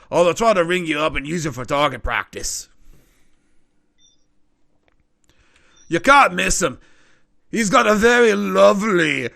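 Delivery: a gossipy barkeep giving directions; gnome barkeep. Acted. Barkeep to the party about the info broker, chatty and loud.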